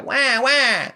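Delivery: put-on very high, loud baby wail; mock baby crying at full volume; pretend real cry. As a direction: bawl "wah" like an infant, hammed-up. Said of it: "now I real cry".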